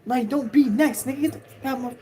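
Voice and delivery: high, loud angry warning; angry threat. Retold or imitated. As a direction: warn him off, loud and hostile. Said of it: "And he like".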